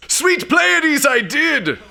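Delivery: high-pitched horrified exclamation; shocked discovery. Acted. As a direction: cry out in comic astonishment. Sudden discovery he really did it.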